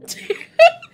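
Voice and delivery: sudden squealing laugh; high burst of laughter. Real, not acted. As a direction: let a giggle escape. Amused by own quote.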